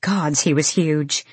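plain reading voice

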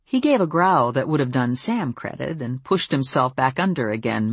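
ordinary delivery, nothing marked

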